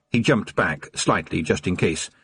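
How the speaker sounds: plain reading voice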